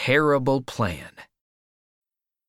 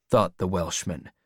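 plain reading voice

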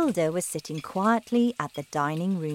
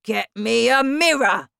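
loud, higher order; demanding father; 6 semitones higher, 7 dB louder than usual. Acted. Husband demanding a mirror, loud. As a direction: bark the order.

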